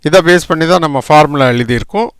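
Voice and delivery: ordinary delivery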